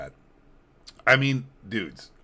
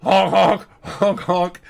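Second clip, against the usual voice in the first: loud high honking like a clown horn; 6 semitones higher, 6 dB louder, much slower than usual. Pretend. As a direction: honk like a silly clown horn. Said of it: "get the honk out".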